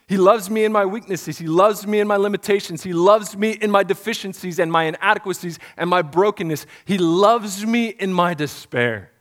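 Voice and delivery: loud, rising sermon build; preaching. Acted. Pastor preaching to the congregation, building force.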